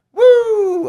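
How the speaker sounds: high, loud, drawn-out "woo"; long high falsetto-style whoop; catchphrase whoop. Retold or imitated. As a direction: whoop it out like a hype man. Host copies guest's signature catchphrase.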